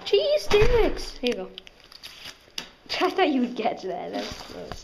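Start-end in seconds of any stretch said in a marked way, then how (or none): 0.0-1.2 high sing-song. Acted. Excited food announcement.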